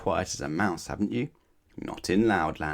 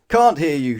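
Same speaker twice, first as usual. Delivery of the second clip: loud, high shouted reply; 8 semitones higher, 11 dB louder than usual. Acted. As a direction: yell it at the timid customer. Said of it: "shouted the grocer".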